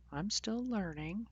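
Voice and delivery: high, slow and sheepish; high apologetic aside. Real, not acted.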